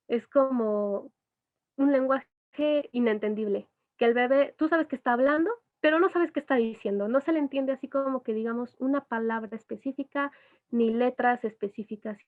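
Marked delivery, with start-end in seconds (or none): none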